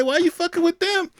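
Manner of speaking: normal talking voice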